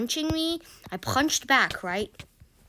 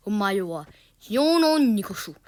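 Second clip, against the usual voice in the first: mock Japanese accent, louder; weird Japanese accent; 5 dB louder than usual. Teacher talking to the boy in a weird Japanese accent. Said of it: "a weird, like, Japanese accent".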